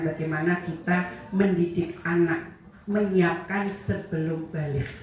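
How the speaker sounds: ordinary delivery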